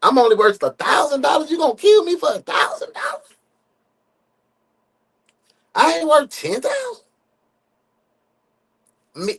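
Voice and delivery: high-pitched comic outrage; mock indignation. Host playing an insulted hostage haggling over ransom, mock indignant. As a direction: squeal it like an offended hostage, playfully.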